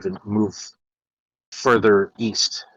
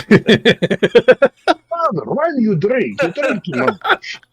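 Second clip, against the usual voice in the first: put-on high, loud pleading child, laughing through it; a kid scolding his drunken father, half laughing; mock child's voice; 12 semitones higher, 4 dB louder, slower than usual. Joking about Soviet fathers, child pleading with drunk father.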